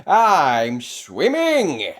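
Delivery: slow, loud, triumphant; thrilled, out of breath; excited gasp. A narrator's reading. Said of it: "he gasped".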